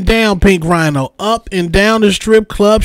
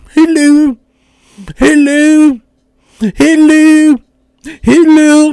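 high, loud, haughty "hello" repeated like a queen; condescending Daenerys; 8 semitones higher, 7 dB louder, slower than usual. Retold or imitated. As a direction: look down from the throne with every hello. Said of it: "That Game of Thrones Daenerys shit".

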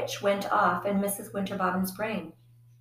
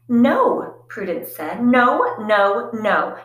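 loud refusal; 3 semitones higher, 9 dB louder, much slower than usual. Acted. Prudence refusing mother watching.

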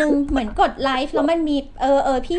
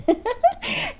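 high-pitched laugh. Real, not acted. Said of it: "ทำไมพี่[name]ต้องมีแบบว่า มีเสียงอย่างเงี้ย".

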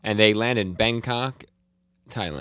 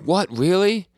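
high, loud, incredulous question; retold surprise; 11 semitones higher, 6 dB louder, slower than usual. Hinchike hears Hio will help, surprised disbelief. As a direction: blurt it in mock astonishment.

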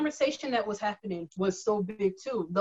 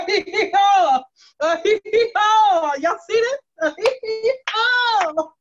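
loud, high whooping hee-haw taunt, laughing through it; gleeful mock cheer; 8 semitones higher, 5 dB louder, much slower than usual. Gloating over toppled statues to a friend, mocking hee-haw cheer. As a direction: crow it like a gloating cowboy. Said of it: "because I'm like".

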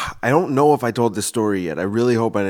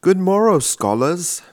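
much higher, louder on morrow, lifting on scholars; mock professor greeting; 10 semitones higher, 4 dB louder, slower than usual. A professor greeting his scholars, mock-grand and high. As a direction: sing it out like a grand old lecturer. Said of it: "That's what I would say if I was a professor".

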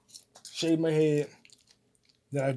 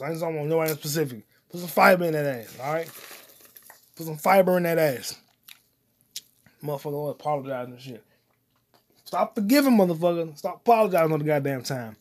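usual speaking voice

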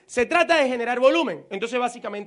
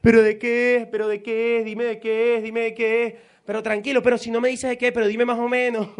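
insistent, nagging friend asking again and again; mock impatient friend on the phone, louder; pushy caller; 5 dB louder than usual. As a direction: badger him to spill it. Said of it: "la gente cuando uno lo invita por teléfono dice esto".

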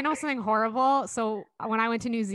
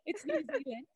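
a far-off, half-hearted New Zealand accent; failed Kiwi accent; 10 semitones higher, 11 dB quieter than usual. Shown as a demonstration. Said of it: "I can't even do a New Zealand accent".

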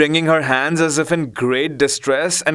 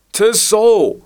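higher, louder call from inside a box; muffled voice; 10 semitones higher, 4 dB louder than usual. Acted. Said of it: "came the muffled voice of the fat man".

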